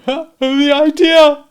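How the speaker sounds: high, slow, loud scoffing lady; Camilla checking a yawn. A narrator's reading. Camilla scoffs to the company, high and drawn out. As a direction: drag out the idea through a yawn. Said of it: "checking a yawn".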